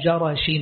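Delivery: جرش said "jarash", short a after ج and ر; fatha on both letters. Shown as a demonstration. Teacher reading the hadith's place name, جرش as "jarash". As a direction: open a vowels in جرش, as "ja-rash". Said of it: "بفتح الجيم والراء".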